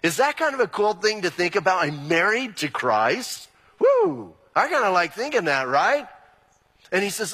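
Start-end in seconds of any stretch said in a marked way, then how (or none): 3.8-4.3 a high, loud whoop of delight; excited whoop. Real, not acted. Pastor thrilled at being married to Christ, high excited whoop. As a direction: let out a happy woo.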